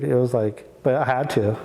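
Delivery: normal talking voice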